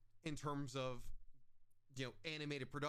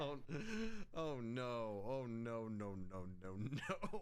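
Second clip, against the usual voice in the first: low, sinking string of no's; dismayed; 5 semitones lower, slower than usual. Real, not acted. Host reacts to the co-host's cosplay plan, low and dismayed. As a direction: drop the pitch in real dismay.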